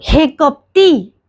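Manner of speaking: slow, drawn-out mock rebuke of a hypocrite; stern rebuke. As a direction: lean hard on हे कपटी, slowly and sternly. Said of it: "क्या कहा गुस्सा से". (धीमी, कड़ी डांट)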